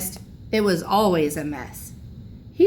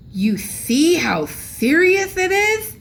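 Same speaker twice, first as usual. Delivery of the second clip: grandpa moaning; 9 semitones higher, 4 dB louder, slower than usual. A narrator's reading. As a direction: moan it out, self-pitying. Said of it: "moaned Grandpa".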